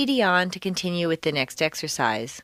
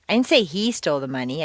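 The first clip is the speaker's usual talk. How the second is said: "he" lifted and emphasized; stress on HE; 3 semitones lower, faster than usual. Shown as a demonstration. Teacher implying someone else took it, stress on "he". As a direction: punch "he" to clear that person. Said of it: "I think someone else took it".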